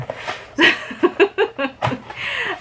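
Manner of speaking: real, high-pitched giggling; a long burst of laughter; laughing. Real, not acted. Reader amused by own retelling of the story, laughing.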